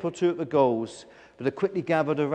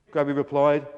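usual speaking voice

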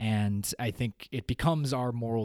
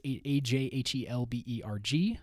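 letter by letter, clearly spaced; spelling it out. Shown as a demonstration. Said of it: "I am on Twitter at A J Helberg".

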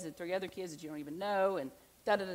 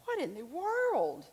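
slow, high-pitched disbelief; drawn out like you can't believe your ears; what on earth, slowly and up high; incredulous; 9 semitones higher, slower than usual. Retold or imitated. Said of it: "and I would think".

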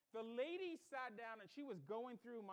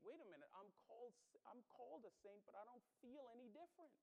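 soft, hurried inner doubt; imagined inner thought; 9 dB quieter, faster than usual. Retold or imitated. Preacher voices a doubting churchgoer's thought, imagined inner thought. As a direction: voice a listener's puzzled thought under the breath. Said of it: "you might be sitting there thinking".

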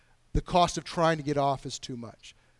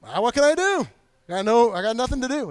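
louder, higher mock shrug; hammed-up helpless excuse; 6 semitones higher, 6 dB louder than usual. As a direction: play the helpless excuse-maker. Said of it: "I'm like".

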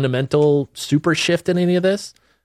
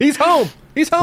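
excited high voice; 3 dB louder than usual. Pretend. Dog heard them, mocking excitement.

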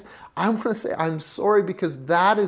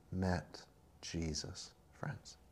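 hushed; 11 semitones lower, 20 dB quieter than usual. Acted. Preacher hushing the congregation, slow and soft. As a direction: drop almost to a whisper, slow. Voice drops to a hush.

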